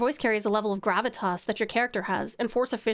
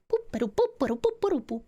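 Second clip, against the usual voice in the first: scatting the rhythm; 3 semitones higher than usual. Shown as a demonstration. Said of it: "that same level of".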